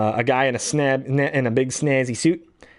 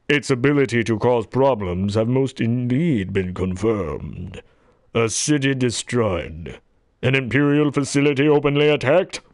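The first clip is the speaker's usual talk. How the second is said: put-on older Darth Vader. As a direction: labour through each phrase with heavy gravity. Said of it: "then this newer one, he's more".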